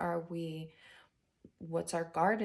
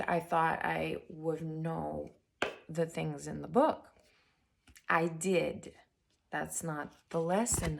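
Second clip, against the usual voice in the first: normal talking voice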